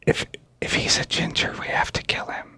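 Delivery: hushed, unvoiced whisper; whispered aside. Player whispering a plot to companions, conspiratorial whisper. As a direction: whisper it under your breath.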